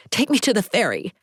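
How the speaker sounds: fast and breathless, panic rising; short of breath. A narrator's reading. Frightened captive begging the driver, panting. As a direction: push the words out between quick breaths. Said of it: "Taking shallow, quick breaths".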